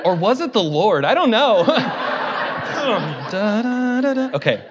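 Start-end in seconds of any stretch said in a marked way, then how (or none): 1.7-3.2 a quick breathy chuckle; short laugh. Real, not acted. Preacher joking to the congregation, chuckling. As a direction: let a laugh slip out. Laughing after "or was it the Lord.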